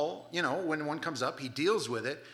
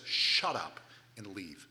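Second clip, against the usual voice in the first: stern command; 8 semitones lower, slower than usual. Retold or imitated. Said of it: "what you yell to the dog".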